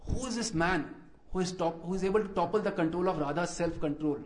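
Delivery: ordinary delivery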